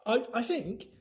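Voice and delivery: put-on flustered boy; hurried. A narrator's reading. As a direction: stammer it out helpfully. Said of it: "said Harry hurriedly".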